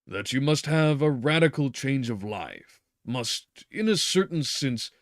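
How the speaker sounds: doctor overriding his patient; interrupting doctor. Acted. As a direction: cut him off with authority. Doctor's retaken line.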